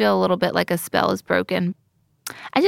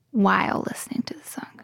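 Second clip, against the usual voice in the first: hushed whisper; breathy murmur, barely voiced; 4 dB quieter than usual. Real, not acted. Whispered aside in studio.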